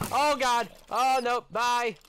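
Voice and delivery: high, loud panicked cries as the creeper blows; panicked yelling. Real, not acted. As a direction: yelp in real panic, then a loud farewell.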